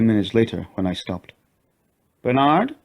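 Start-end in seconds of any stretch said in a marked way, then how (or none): 2.2-2.8 higher questioning call. A narrator's reading. Katie calls for Bernard, higher uneasy call. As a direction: call into the dark tunnel. Calling into tunnel.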